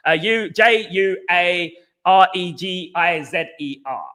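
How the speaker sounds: "J U A R E G I Z E R" spelled letter by letter, slowly; spelling out the name. Shown as a demonstration. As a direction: read the letters out one by one, slowly. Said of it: "I think that's how you say it".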